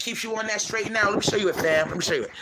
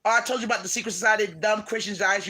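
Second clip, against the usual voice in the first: usual speaking voice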